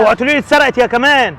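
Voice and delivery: loud, high, dreading; angry disbelief. Acted. Father dreading news of stolen money, loud. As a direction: warn her it better not be stolen.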